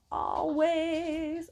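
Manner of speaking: long drawn-out "Always"; high, held "Always" for emphasis; emphatic. Real, not acted. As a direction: stretch "Always" right out, high and sustained. Drawing out the repeated word for emphasis.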